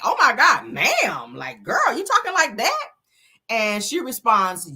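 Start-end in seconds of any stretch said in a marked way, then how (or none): 0.0-2.9 high, scandalised squeal; exaggerated mock surprise; mock shock. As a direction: gasp at her boldness, pitch shooting up.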